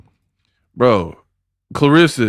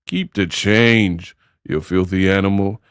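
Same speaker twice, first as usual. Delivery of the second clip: Home Alone gangster; 6 semitones lower, 3 dB quieter than usual. Retold or imitated. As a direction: drag out the famous tough-guy line low. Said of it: "the gangster in the Home Alone video".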